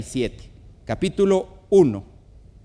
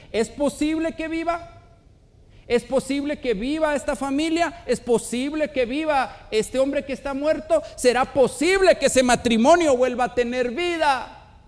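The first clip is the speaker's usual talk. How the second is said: higher pitch, building to a fervent peak; impassioned preaching questions; 9 semitones higher than usual. Acted. Preacher asking whether dead families can live, urgent rising appeal. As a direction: press each question upward.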